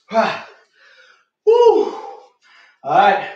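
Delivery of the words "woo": high, loud whoop of triumph; joyful yell after finishing the set; excited whoop. Real, not acted. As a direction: let out a big celebratory woo. Hype shout after the set.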